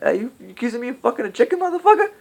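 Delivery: hammed-up movie tough guy, offended; mock outraged yell. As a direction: bark it out as a furious accusation. Repeats the angry movie line.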